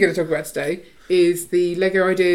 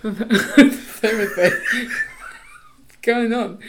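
slow, giggling through the words; can't stop laughing; 4 semitones higher, much slower than usual. Real, not acted. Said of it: "now I can't stop it".

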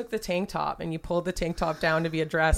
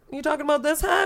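high-pitched questioning; 10 semitones higher, 4 dB louder, faster than usual. Retold or imitated. Quotes the officer holding up hat.

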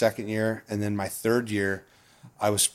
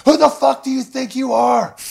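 furious high-pitched yell, far louder; angry shout; 9 dB louder than usual. Retold or imitated. Coach confronting rookie pitcher on the mound, enraged yelling. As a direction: scream it right in the player's face.

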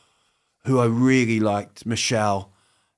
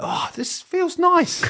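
high, slow dreamy put-on voice; drawn-out mock contentment; mock blissful sigh; slower than usual. Comedian mocking people pleased with their boxes, high dreamy voice.

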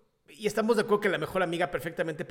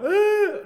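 mock wail; 10 dB louder than usual. Mocking a dumped person's wail.

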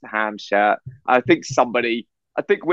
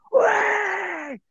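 high, drawn-out whoop; imitated crowd cheer. Festivalgoers greeting the only English people, long high cheer.

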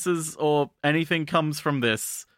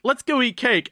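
higher, bright mock suggestion; cheery cake invite; 9 semitones higher, 3 dB louder than usual. Girls invite the friend with no taste, mock cheerful. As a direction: pitch it up like an eager friend. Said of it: "if they were like".

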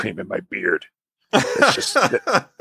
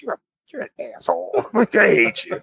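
mock indignant; 14 semitones higher than usual. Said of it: "I'm just like".

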